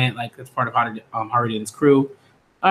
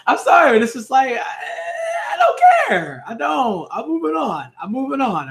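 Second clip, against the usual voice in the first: much higher, squealing through laughter; laughing squeal; 11 semitones higher than usual. Real, not acted. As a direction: crack up and shriek it out. Laughing fit over manga panel.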